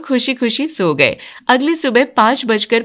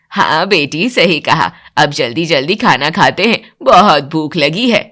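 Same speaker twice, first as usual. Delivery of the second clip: lower, approving elder voice; mother-in-law; 6 semitones lower than usual. Acted. Mother-in-law answering the new bride, lower voice. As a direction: praise lightly as the house matriarch.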